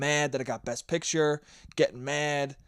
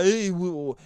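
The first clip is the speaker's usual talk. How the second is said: low, mumbled goomba voice; Italian goomba; 3 semitones lower, 3 dB quieter than usual. Acted. A dumb Italian out there, hammed-up low goomba voice. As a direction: put on a dopey goomba mumble. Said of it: "There are some Goomba".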